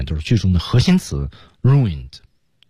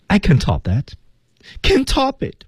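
higher-pitched mock despair, each line falling; acting the desperate King; 12 semitones higher than usual. Shown as a demonstration. Teacher walks students through the five-gesture scene in mock despair.

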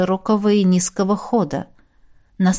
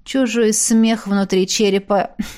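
usual speaking voice

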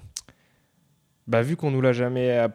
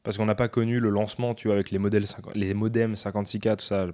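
ordinary talk, nothing marked

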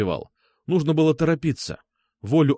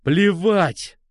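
higher, forceful angry yell; 5 semitones higher, 4 dB louder than usual. A narrator's reading. Сергей explodes at Алёна over the phone, yelling. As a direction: burst out yelling. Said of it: "заорал я".

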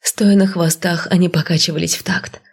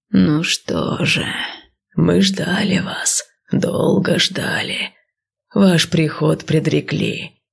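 serpent seer voice; slower than usual. Acted. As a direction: breathe it out like an old serpent. Snake leads them on.

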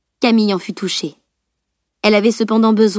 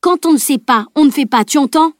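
higher, louder furious rebuke; 6 semitones higher, 3 dB louder than usual. A narrator's reading. Salim rebukes Camille in front of the others, furious. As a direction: hammer each phrase at her. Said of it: "attiser sa fureur".

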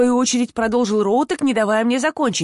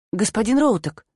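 ordinary delivery

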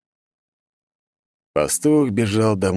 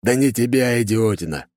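growled; 4 semitones higher than usual. A narrator's reading. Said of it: "рыкнул голос из мобилета".

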